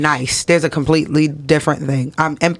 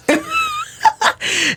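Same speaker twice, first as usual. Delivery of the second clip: high squealing laugh, cracking up; burst of laughter. Real, not acted. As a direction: break into a high real laugh. Laughs at own retold reply.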